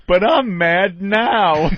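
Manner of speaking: slow, loud, dopey Homer voice, drawn out; a loud, slowed mock Homer line; Homer impression. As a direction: do Homer Simpson, slow and dumb. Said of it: "the Homer line from the Simpsons".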